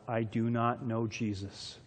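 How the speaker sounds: slow, low and hushed; grave spoken denial. Retold or imitated. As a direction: say it softly and slowly, letting it weigh. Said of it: "or to say out loud".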